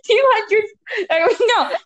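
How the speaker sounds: high, breaking into laughter; cracking up mid-answer; trying not to laugh. Real, not acted. Giggling while guessing the number.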